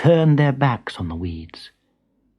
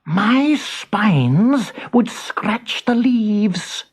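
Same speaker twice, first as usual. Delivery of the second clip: very slow porcupine excuse; lazy porcupine's slow excuse; making an excuse; 7 semitones higher, much slower than usual. A narrator's reading. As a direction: drawl the excuse lazily as Porcupine. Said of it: "making an excuse".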